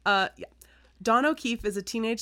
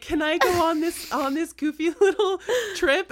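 higher, louder goofy plea with a laugh; mock eager asking; 8 semitones higher, 5 dB louder than usual. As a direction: ask like a giddy kid pitching a silly idea. Acting out her own silly request.

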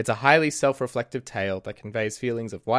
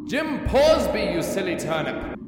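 yelled out; 8 semitones higher than usual. Acted. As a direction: hurl the name across the tunnel. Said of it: "I yelled out to the spectre".

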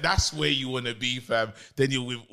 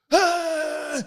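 celebration shout; 3 dB louder than usual. Retold or imitated. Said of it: "They score, they go".

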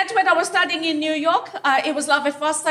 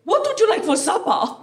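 a higher, lightly voiced posh mother; posh woman; 4 semitones higher than usual. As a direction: coo at the child like a posh lady. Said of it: "a very posh woman".

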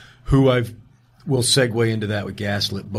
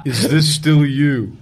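slow, louder mock-spy read of a text message; mock mysterious; 4 semitones higher, 4 dB louder, slower than usual. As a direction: read it slowly like a secret agent. Reading mysterious text aloud.

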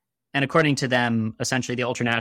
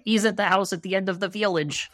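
put-on much higher-pitched Russian accent; Kamarov; 8 semitones higher than usual. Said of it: "that's my Russian accent".

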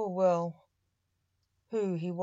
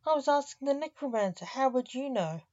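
high, fast, mocking laugh through the words; 6 semitones higher, 3 dB louder, faster than usual. A narrator's reading. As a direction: tease him with a quick laugh. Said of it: "laughed the chicken".

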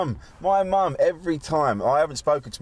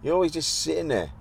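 mock pleading with mum; 7 semitones higher than usual. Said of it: "I'm like".

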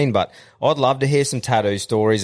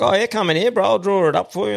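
lightly voiced keen tattoo artist, pitched higher; eager tattoo artist; 9 semitones higher, faster than usual. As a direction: imitate a salesy artist hooking a customer.

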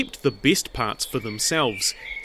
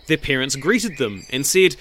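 ordinary delivery

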